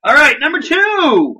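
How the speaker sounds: high, loud, drawn-out announcement; countdown announcer. Acted. Host revealing the next movie in the countdown, announcer style. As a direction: belt out the ranking like an announcer.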